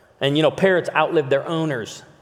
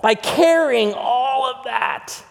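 pleading preach; 10 semitones higher, 4 dB louder, much slower than usual. Real, not acted. Preacher pressing the point.

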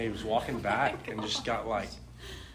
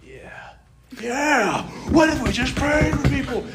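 high, loud excited shout; worked-up excitement; 10 dB louder than usual. Retold or imitated. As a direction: burst out as the idea grips you. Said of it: "I was just getting so worked up".

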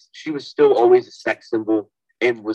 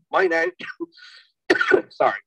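coughing while choking on food; words cut off by a cough; choking on a pretzel; 7 semitones higher than usual. Real, not acted. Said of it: "choking on a fucking pretzel".

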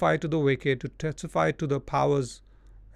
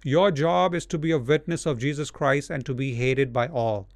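usual speaking voice

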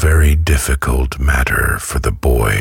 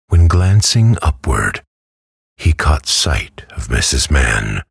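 ordinary delivery, nothing marked

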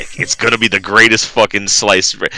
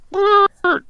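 high trilled horn noise; short, high buzzing toot; imitated party horn. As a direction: mimic a little party blower.

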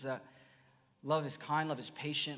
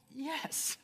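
a tiny squeaky yes, much higher; meek squeak; 12 semitones higher than usual. Retold or imitated. Pastor replying to God in a squeaky high yes. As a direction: answer like a humbled little kid. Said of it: "And I'm like".

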